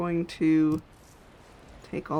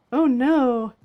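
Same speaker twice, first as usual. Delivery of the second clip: surprised; 6 semitones higher, 8 dB louder than usual. Real, not acted. Realizes armor is lost.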